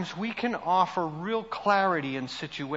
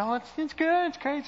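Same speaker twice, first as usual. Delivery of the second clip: high, bright bubbly voice; imitating a bubbly friend; bubbly and happy; 10 semitones higher than usual. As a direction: chirp it like a cheerful southerner. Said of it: "She's all bubbly and happy".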